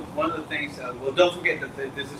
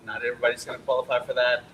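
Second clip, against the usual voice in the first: ordinary talk, nothing marked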